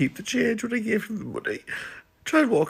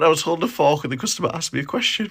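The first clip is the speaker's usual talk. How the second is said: ordinary talk, nothing marked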